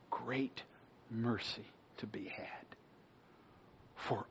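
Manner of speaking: hushed whispered promise; gentle whisper to the sinner. Real, not acted. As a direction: drop to an unvoiced whisper of mercy. Tender appeal to non-believers.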